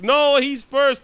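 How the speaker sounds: high, loud and slow, drawn out; hammed-up insistence from the deli woman; mock insistent shout. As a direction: stretch it out loud like a stubborn shopkeeper. Mimics the woman insisting loudly.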